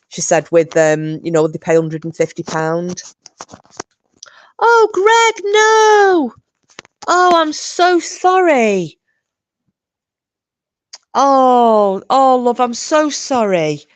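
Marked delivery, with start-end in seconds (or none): 4.6-9.0 high, louder, pained cry of sympathy; heartbroken sympathy. Real, not acted. To a viewer who lost his dog, high heartbroken sympathy. Reacting to viewer's dead pet. 11.1-13.8 higher, slow, consoling; tender sympathy. Real, not acted. Consoling a viewer over his dead dog, high slow tender sympathy.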